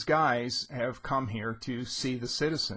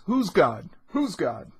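higher, louder indignant snapping question; angry retort; 5 semitones higher, 6 dB louder than usual. Retold or imitated. The citizen challenging Bloom in the pub, angry loud retort. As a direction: fire it back at him, offended. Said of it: "the citizen now is angry".

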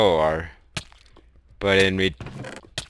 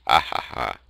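mock evil laugh; villain laugh; 9 semitones lower, 4 dB quieter than usual. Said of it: "That's my intercom voice".

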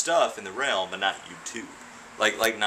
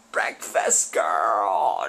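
slow, drawn-out shout; slow playful shout; calling out; 3 semitones lower, much slower than usual. Real, not acted. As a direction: bellow her nickname across the room. Calling out partner's nickname.

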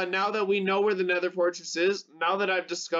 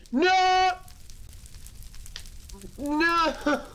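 panicked scream; 12 semitones higher than usual. Real, not acted. Gamer getting hit by mobs, screaming no in panic. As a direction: scream it as you get hit. Mob attacks him in game.

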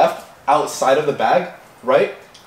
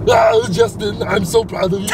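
Arnold Schwarzenegger voice; 6 semitones higher than usual. Shown as a demonstration. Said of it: "you sound like Arnold Schwarzenegger".

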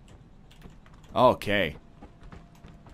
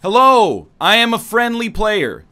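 loud announcing; 7 semitones higher, 8 dB louder, slower than usual. Pretend. Announcing to strangers in game.

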